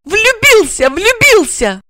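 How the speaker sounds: high, loud furious accusation; furious blurt. A narrator's reading. Regina furiously accuses Igor, high and loud. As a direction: fire it at him in rage.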